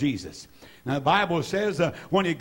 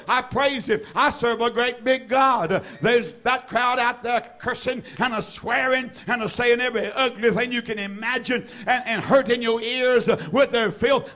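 higher-pitched, driving sermon run; fervent preaching; 6 semitones higher than usual. Real, not acted. Preacher testifying that he praises God everywhere, fervent. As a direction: pour it out in a rising, relentless cadence.

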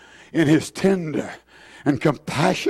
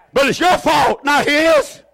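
preaching shout; 7 semitones higher than usual. Real, not acted. Preacher rebukes the congregation.

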